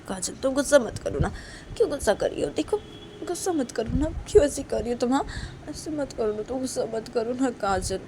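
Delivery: high-pitched coaxing; anxious, insistent appeal; pleading. Acted. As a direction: beg her to calm down. Pleading with an angry friend.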